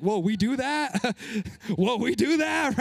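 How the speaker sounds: a surprised visitor gasping whoa, rising on the second; wide-eyed, half-laughing disbelief; mock surprise. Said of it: "You're like".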